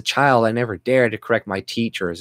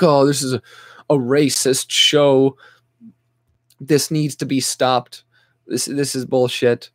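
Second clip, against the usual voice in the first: mocking offended viewers; 4 semitones higher than usual. Mocking newcomers offended by a parody show, whiny mock voice. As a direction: drag it out as a whiny complainer. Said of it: "then they're like".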